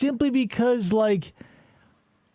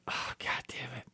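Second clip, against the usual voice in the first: whisper; 16 dB quieter than usual. Real, not acted. Frustrated aside while talking.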